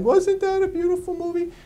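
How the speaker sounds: mock a teary girl gushing over a film. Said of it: "the girl comes out crying".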